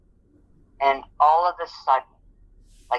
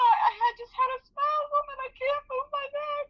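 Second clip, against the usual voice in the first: much higher, sobbing half-shout; crying out; 3 dB quieter than usual. Retold or imitated. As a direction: wail it through tears. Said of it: "I was crying".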